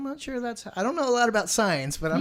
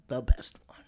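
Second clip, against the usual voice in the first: whispered aside; 6 semitones lower than usual. Real, not acted. Aside about favourite Cinderella.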